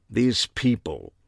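slow British disdain; slow, mannered British voice; affected British accent. A narrator's reading. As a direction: draw it out in disapproving British tones. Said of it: "a British accent or a deep-seated affectation".

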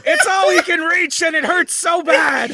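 mock sob through laughter; laugh-crying. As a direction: wail it while laughing. Dwarf laugh-crying about pain.

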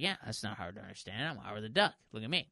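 low, quiet cartoon duck. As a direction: talk like a grumpy duck. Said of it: "It's like Penguin now".

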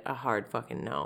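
very soft, low retold no; low deadpan refusal. Retold husband turning down the egg idea, low deadpan. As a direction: drop right down for the refusal.

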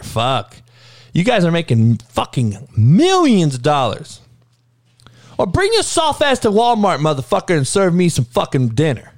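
ordinary talk, nothing marked

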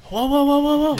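high, loud string of whoas; mock alarm. Guide replaying a client walking off the boat, mock alarm. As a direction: imitate someone urgently trying to stop her.

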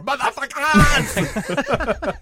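high, long drawn-out holler; mock battle cry. Co-host imagines kid opening the door, mock holler. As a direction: burst in with a drawn-out comic yell.

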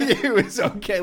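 laughing through it, slow and high. Real, not acted. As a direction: giggle it out, drawn out. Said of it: "listener laughs at repeat".

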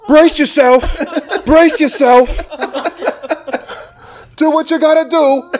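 high, loud cornerman yell; mock trainer's shout. Podcaster quoting a boxing trainer's corner advice, high mock shout. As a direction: holler it high, drawing out the words.

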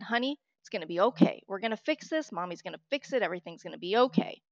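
ordinary delivery

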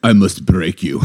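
put-on Russian accent movie villain; menacing line in a put-on Russian accent; Drago. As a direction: play a Russian boxer with a heavy accent. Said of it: "I'm Drago".

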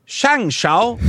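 SHANGQIAO leaping high out of the sentence; exaggerated Chinese tones. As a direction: overdo the tones on SHANGQIAO, rising at the end. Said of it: "Listen to the pronunciation".

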